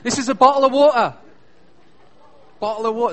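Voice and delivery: loud, quick British "bottle of water", no final R. Shown as a demonstration. Brit teaching Americans his pronunciation, emphatic British "bottle of water". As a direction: punch out "water" as "waw-tuh", high and loud. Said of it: "in Britain is called".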